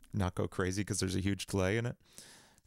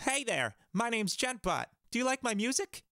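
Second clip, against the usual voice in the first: much higher, sing-song bot persona; silly chatbot voice; 13 semitones higher than usual. Acted. Playing a chatbot asking if viewers like his music. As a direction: play a goofy music bot. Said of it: "say some stupid stuff".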